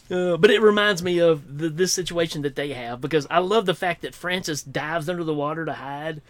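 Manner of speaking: ordinary delivery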